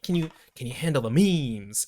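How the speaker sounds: a light Denzel imitation, memes falling at the end; Denzel impression. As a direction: mimic the actor's odd inflection on memes. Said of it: "the inflection on memes is so funny".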